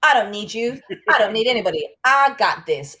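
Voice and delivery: loud, high, defiant bravado; ego talking. Retold or imitated. Host voicing ego's defiant shout, loud and high. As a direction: shout it like puffed-up pride. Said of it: "it's ego".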